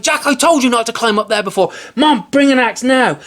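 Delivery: high, loud, fast cry; frantic shouting. A narrator's reading. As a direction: shout in alarm. Mother scolds, Jack yells.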